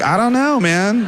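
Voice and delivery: high-pitched helpless reply; acted cluelessness. Retells clueless reply to tech.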